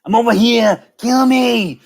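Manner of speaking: high, strained action-hero impression; Arnold Schwarzenegger. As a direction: push it out like an Austrian action star. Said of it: "doing Arnold voice".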